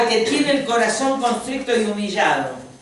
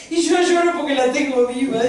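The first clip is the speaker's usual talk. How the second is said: high-pitched mock wail, played for laughs; mock crying; 6 semitones higher, 4 dB louder than usual. Friend explaining her fake tears on Mother's Day, mock crying. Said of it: "la otra se hacía que lloraba".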